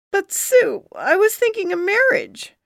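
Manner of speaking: high, squeaky, pleading voice; plead, voice cracking up high. A narrator's reading. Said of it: "Charlie pleaded as his voice squeaked".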